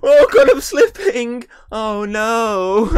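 high, loud hammy cry of panic; overacted death scene. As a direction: wail it like you're falling. Playacting slipping off the statue.

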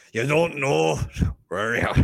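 a jokey Scottish growl of a threat; Scottish accent impression. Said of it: "we didn't bring you on to do accents".

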